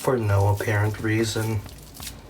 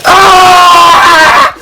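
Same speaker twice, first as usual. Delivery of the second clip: huge primal battle yell; barbarian scream; 18 dB louder than usual. Pretend. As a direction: scream like a caveman whipping meat. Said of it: "you have to scream like a barbarian".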